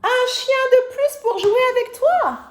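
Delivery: high, loud excited announcement; excited reveal. Acted. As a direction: reveal it with delight. (révélation enthousiaste)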